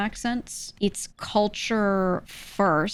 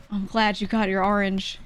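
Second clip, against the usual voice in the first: ordinary talk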